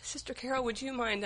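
asking for help through tears; tearful request. Real, not acted. Speaker to Sister Carol, tearfully asking her to pray. Asks for prayer while crying.

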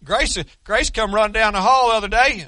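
usual speaking voice

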